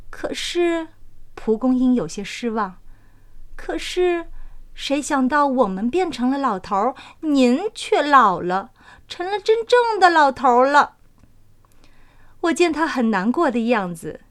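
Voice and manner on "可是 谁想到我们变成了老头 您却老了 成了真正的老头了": higher voice turning a little sad; the flower spirit, let down and sorry; disappointed dandelion. Acted. Dandelion grieves that the man grew old, higher, a touch sad.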